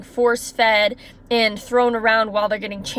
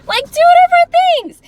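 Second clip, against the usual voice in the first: very high, loud squeal of exasperation; exasperated insistence; 8 dB louder than usual. Real, not acted. Venting about a manager who won't listen, exasperated.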